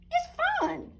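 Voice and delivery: put-on very high little-girl voice; a small girl cheerfully coaxing; Sarah coaxing. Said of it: "said Sarah".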